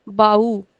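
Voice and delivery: bau with AU stretched; stress on last syllable. Shown as a demonstration. Teacher models bau for students, stretching the last syllable. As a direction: draw out au in bau. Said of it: "accent is on the last syllable".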